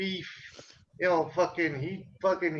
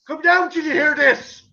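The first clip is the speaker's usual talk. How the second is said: higher, louder call up the stairs; calling upstairs; 8 semitones higher, 7 dB louder than usual. Retold or imitated. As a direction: call mom down from the bathroom. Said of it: "he's like".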